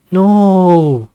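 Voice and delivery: a desperate cry to stop him leaving; loud no. Acted. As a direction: shout it out, high and loud. Siggy refuses to let the guest go.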